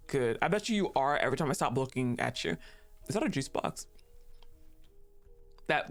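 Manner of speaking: normal talking voice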